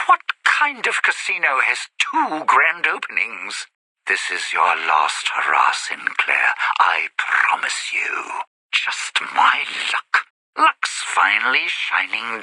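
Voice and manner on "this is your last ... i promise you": sinister low promise; menacing vow. Acted. Low vow against an absent rival.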